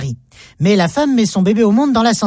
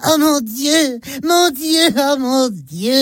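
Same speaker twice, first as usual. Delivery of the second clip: high, changed-voice mock cry of dismay; lightly imitating a mother's dismayed cry on the scale; mom crying out; 4 semitones higher, much slower than usual. Girl imitating mom on the scales, high mock cry. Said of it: "elle crie".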